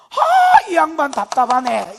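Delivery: high, loud exasperated sigh at Jesus; exasperated servant. Acted. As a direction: huff it out like an impatient official. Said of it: "신하가 봤을 때".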